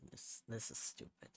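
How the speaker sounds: breathy whisper, fuming in fun; mock-furious whisper; whispered mock rage. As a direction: whisper it like you're about to snap. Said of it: "Can't you hear by the sound of my voice?".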